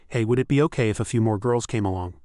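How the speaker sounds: ordinary delivery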